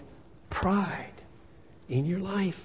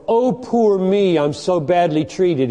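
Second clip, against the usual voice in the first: high, louder whiny poor me; mocking self-pity; 6 semitones higher, 6 dB louder, slower than usual. Preacher mimicking the weak's self-pity, whining. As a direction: ham up the wounded whine. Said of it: "in the weak".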